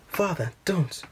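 high-pitched, pleading cry. A narrator's reading. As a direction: cry out to stop your father. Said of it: "I cried".

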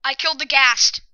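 loud triumphant cheer. Real, not acted. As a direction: shout the win out. Triumph after killing ghast.

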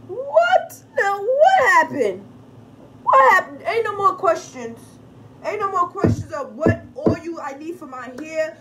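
loud, high, exasperated yelling; exasperated shout. Real, not acted. Vlogger yelling at viewers who keep asking questions, loud and exasperated. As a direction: throw the words out loud and high in disbelief.

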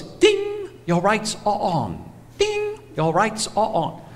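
lightly voiced robotic car chime, high ding; a hint of a prim talking-car voice, high on the ding; mock car voice announcing the lights, soft and slow; truck's talking voice. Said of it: "this Chinese woman's voice comes out".

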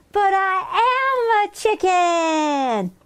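put-on high, drawn-out childish whine; wailing protest. Henrietta insisting to her sisters, wailing protest.

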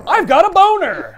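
high, loud declaration; mock proud exclamation. Host imagining the phrase, mock proud exclamation. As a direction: announce it proudly. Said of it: "it would sound like I am saying".

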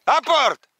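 high loud command to the dog; shouted fetch command. Real, not acted. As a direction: call it out across the reeds.

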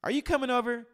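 high-pitched, chatty mock question; casual asker. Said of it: "in our watered down language, we say".

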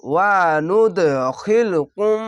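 ওয়া নুদখিলকুম chanted slowly with a bouncing দ; recitation with qalqala. Shown as a demonstration. Teacher reciting for students learning idgham. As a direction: echo the দ in নুদখিলকুম. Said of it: "এখানে কলকলা হবে".

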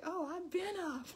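high, loud morning-person boast; hammed-up chipper holler; mock yelling. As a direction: crow it loudly, wide awake. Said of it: "Why are you yelling?".

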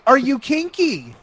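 high, slow, pointed question; mock insistent question; imitating the woman. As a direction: draw it out high as her. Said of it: "She was like".

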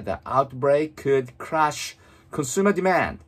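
very slow, fully articulated English reading; read-aloud model. Shown as a demonstration. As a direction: move the lips fully, reading slowly for shadowing. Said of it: "本当に大きな声で唇を思いっきり動かして". (口を大きく動かしてゆっくり音読)

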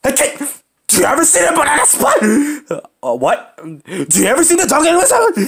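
high, fast, shouted goofy questions; silly high teasing voice. Pretend. Kid pesters friend with nonsense questions, squeaky and shouting.

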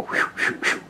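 soft whooshing "whew"; movie silencer noise. Retold or imitated. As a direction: imitate a silenced gun with airy puffs.

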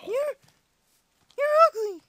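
loud, high-pitched taunt; shouted insult. Real, not acted. Player insulting a game enemy, shouted taunt. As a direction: yell the insult out.

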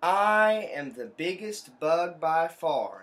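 loud, slow bragging bug voice; big slow boast for the kids; boastful bug. Acted. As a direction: boom it out slowly like a proud little bug. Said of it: "He says".

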